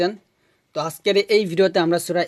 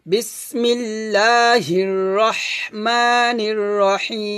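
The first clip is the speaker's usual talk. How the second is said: slow, drawn-out melodic tajweed recitation; Quran recitation; 3 semitones higher, much slower than usual. Shown as a demonstration. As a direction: recite it slowly with a melodic chant. (ধীর সুরেলা কুরআন তিলাওয়াত)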